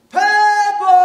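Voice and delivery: loud sing-song paper call; newsboy street cry. Acted. Newsboy crying his papers.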